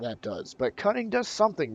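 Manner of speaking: normal talking voice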